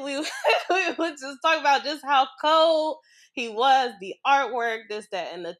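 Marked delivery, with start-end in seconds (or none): 0.0-3.9 louder, laughing fondly. Real, not acted. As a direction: laugh at the happy memory. Fond memory of shared show.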